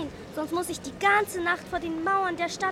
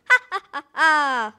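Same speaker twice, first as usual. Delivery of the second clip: evil cackle; 2 semitones higher, 6 dB louder than usual. Acted. Witch laughs after curse.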